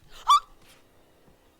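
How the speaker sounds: high, loud shocked gasp; sharp loud gasp. Real, not acted. Player startled mid-game, loud high gasp.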